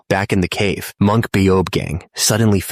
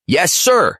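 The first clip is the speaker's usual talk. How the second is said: eager shout; 11 semitones higher than usual. A narrator's reading. Monk answers order.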